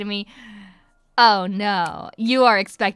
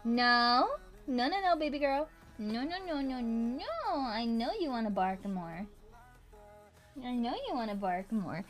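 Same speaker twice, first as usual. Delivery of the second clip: soft shushing the dog; 9 dB quieter than usual. Real, not acted. Calming her barking dog.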